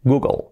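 Google ending like Apple; "GOO-gol" with a light L; English pronunciation. Shown as a demonstration. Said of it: "como Apple".

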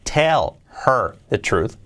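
slow, deliberate model sentence; slow careful model. Shown as a demonstration. Teacher modelling the corrected question slowly for students. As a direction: spell it out slowly for learners. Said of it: "lo correcto sería".

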